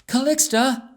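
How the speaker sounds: loud, high call down the corridor. A narrator's reading. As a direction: call out for her friend. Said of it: "ran to the corridor and called out".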